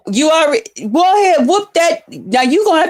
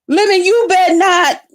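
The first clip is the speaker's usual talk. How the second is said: ordinary delivery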